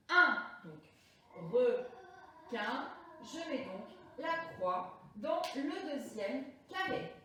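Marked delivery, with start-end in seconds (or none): 0.1-0.4 "un" alone, punched loud as one nasal vowel; isolated target sound. Shown as a demonstration. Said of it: "c'est le son". 1.5-2.9 "requin" split, long pause before the last syllable; découpage syllabique. Shown as a demonstration. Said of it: "c'est le son".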